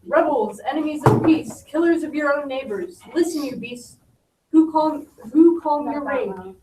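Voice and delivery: loud commanding prince; powerful voice. Acted. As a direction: proclaim it loudly to the crowd. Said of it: "Powerful voice".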